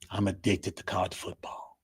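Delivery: ordinary talk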